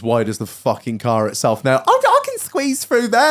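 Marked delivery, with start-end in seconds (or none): 1.9-3.3 high, drawn-out mimicry of a driver; mock overconfident driver. Driver sizing up the gap, high mock-confident voice. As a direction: put on a high, slow daft driver.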